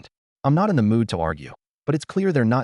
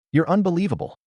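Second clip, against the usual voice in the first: a mild narrated yell, pitched up; understated yell at her brother; 9 semitones higher than usual. A narrator's reading. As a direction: read her outburst with restrained heat. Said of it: "she yells".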